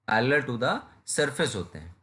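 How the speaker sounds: slow, each word spaced out for notes; slow dictation. Shown as a demonstration. Teacher repeating the definition slowly so students copy it. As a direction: say it slowly so students can write it down. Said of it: "teacher repeats the definition for notes".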